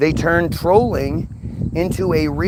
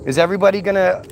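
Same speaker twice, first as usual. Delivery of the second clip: ordinary talk, nothing marked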